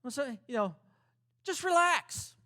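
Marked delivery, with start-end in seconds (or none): 1.4-2.3 high, loud mock soothing; sarcastic calm-down line, pitched up. Shown as a demonstration. Preacher mocking a useless calm-down line, mock soothing. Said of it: "That fixes things, doesn't it?".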